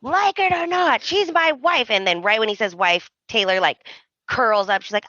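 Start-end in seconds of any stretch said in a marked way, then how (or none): 0.0-1.9 much higher mock declaration; mock Ridge. Ridge declaring Brooke his wife, hammed up high. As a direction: ham up the husband's vow.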